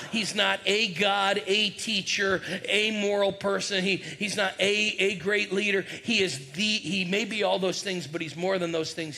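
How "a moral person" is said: usual speaking voice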